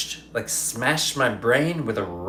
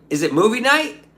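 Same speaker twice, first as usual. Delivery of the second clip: mock cheeky question; 10 semitones higher, 5 dB louder than usual. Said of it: "I was like".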